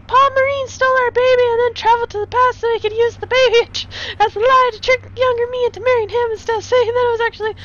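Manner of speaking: high put-on lady voice; high female voice, barely holding in laughs; future Sophie voice. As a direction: pitch way up, earnest and giggly.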